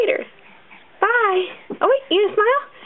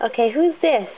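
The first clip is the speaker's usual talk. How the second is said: usual speaking voice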